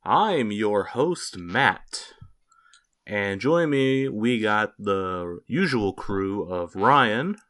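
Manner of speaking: slow, loud, carefully spelled-out intro; English teacher voice. Pretend. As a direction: talk like a patient English teacher to learners. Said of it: "I'm gonna do this in my English teacher voice".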